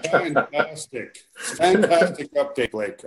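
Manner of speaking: slow dry sarcasm; mock praise, drawn out; sarcastic. Real, not acted. Teasing a short update.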